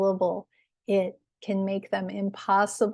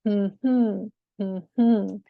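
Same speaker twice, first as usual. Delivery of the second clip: short hum then longer hum; two-beat demonstration hum, second beat stressed; hummed stress pattern; 3 dB louder than usual. Shown as a demonstration. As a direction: hum a weak beat and a strong beat. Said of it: "When we hum it".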